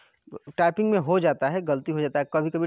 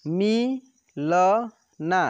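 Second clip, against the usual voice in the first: ना and रा stretched apart, louder; very slow syllables; 4 dB louder, much slower than usual. Shown as a demonstration. Teacher sounding out syllables for pupils, very slow. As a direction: spell it out slowly, syllable by syllable. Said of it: "direct आपको बोलना है कि".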